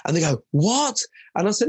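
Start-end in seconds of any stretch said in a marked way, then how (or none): 0.5-1.0 high, loud mock-shocked "What?"; shocked parent. Parent hearing the anti-bullying joke, shocked. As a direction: play the stunned parent.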